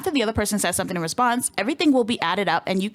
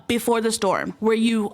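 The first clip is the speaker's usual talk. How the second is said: usual speaking voice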